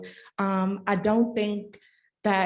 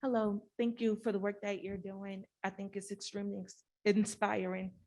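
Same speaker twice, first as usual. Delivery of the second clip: barely audible, very soft voice; soft-spoken; 9 dB quieter than usual. Real, not acted. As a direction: speak so softly it hardly carries. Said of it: "I can barely hear you".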